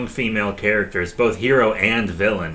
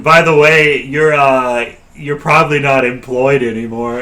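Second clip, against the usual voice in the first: much louder, deliberate delivery; raised-voice mock notice; loud announcement; 8 dB louder than usual. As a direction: break the news loudly to a guard. Adventurer breaking news to guard.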